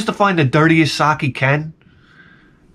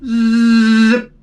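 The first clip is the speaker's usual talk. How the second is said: quick high zip noise; sharp high sound effect; imitated machine zip; 6 semitones higher, 4 dB louder than usual. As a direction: mimic the ATM sucking in a check. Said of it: "it just goes like".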